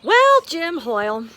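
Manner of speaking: big cheerful hello; loud greeting. Real, not acted. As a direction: call out his name, loud and bright. Loud greeting opening video.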